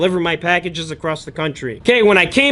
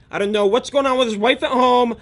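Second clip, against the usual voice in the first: ordinary talk, nothing marked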